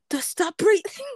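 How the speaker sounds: ordinary talk, nothing marked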